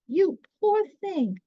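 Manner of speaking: high, kindly little mouse voice; kindly field mouse. A narrator's reading. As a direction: coo it like a kind little mouse. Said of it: "said the kindly field mouse".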